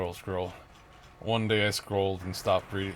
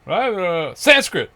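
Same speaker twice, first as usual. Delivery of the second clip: much higher, louder mocking blurt; mock shout; 10 semitones higher, 13 dB louder, slower than usual. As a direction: blurt the last word right at me. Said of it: "right when he walks by me".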